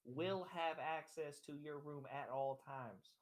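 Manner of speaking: ordinary talk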